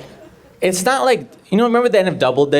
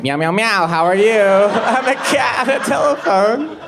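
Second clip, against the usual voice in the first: silly cat voice climbing higher; cat on telephone; 6 semitones higher than usual. Pretend. Comedian playing a cat chatting on the phone, silly rising cat voice. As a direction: meow it out, pitch rising into a squeak. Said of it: "her eight cats were on the phone".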